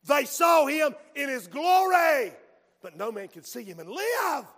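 loud, slow preacher's cry; preaching shout. Acted. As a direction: proclaim it with full force.

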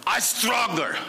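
high, growling mock-Russian accent; fierce put-on Russian accent, growled; terrible Russian accent. Father glares at his cigarette, growling in a Russian accent. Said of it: "Forgive my terrible Russian accent".

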